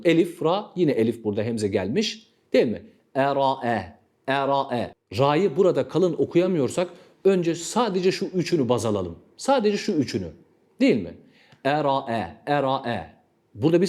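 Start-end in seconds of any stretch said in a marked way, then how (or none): none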